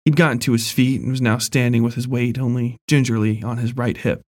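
ordinary delivery

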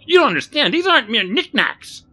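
loud, high-pitched indignant protest; agitated protest. A narrator's reading. Barry defends his shattered merchandise to Rodney, agitated. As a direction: protest loudly, wounded and cross. Shopkeeper scolds over broken glass.

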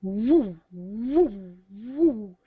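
loud mock wailing woo; rising, loud imitated woo; imitated woo sound. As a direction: hoot out the eerie woo noise. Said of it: "it was just this".

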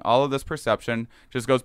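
ordinary talk

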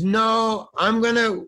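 high, drawn-out retold no; insistent refusal. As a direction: refuse in a high mock-director voice. Retelling director refusing cinematographer.

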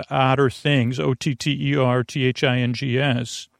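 ordinary talk

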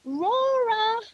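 high, drawn-out calling out; yelled call. Retold or imitated. Grandmother calling out for missing child, high searching call. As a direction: call a name far across the bush, long and high. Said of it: "I was yelling out".

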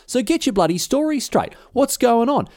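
spring it on them in a high indignant demand; accusing outburst. Retold or imitated. Alcibiades double-crossing the ambassadors in the assembly, high indignant outburst.

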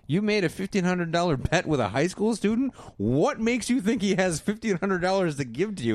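high-pitched mock disbelief; incredulous scolding. Friend questioning a bet with a student, incredulous.

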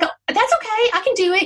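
high, chirpy mock optimism; upbeat sister-in-law. Imitating her sister-in-law, high and breezy. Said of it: "she was, like, all positive about it".

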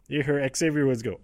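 ordinary talk

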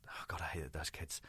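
hushed, whispered confession; muttered aside. Real, not acted. Comic confesses about panto kids, muttered aside. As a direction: drop it low under your breath. Confidential aside during the story.

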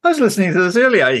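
high, quick, through laughter; giggly retelling, fast and high; laughing. Retold or imitated. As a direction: laugh while you say it. Retold remark laughing.

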